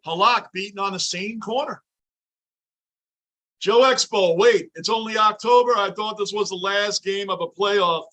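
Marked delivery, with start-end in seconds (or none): none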